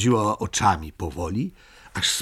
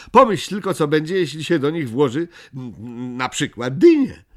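high, loud, delighted exclaiming; overjoyed; 8 semitones higher, 7 dB louder than usual. A narrator's reading. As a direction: gush it out in a raised, excited voice. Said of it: "zawołała mama Muminka uradowana". (radośnie, wysokim głosem)

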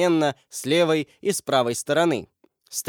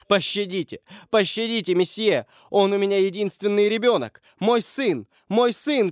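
high-pitched desperate begging; pleading; 6 semitones higher than usual. A narrator's reading. Parents beg the attacker to spare their only son, pleading. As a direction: beg for mercy, high and urgent. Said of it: "начал умолять".

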